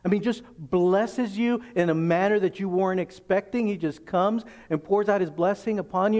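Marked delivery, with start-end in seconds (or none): none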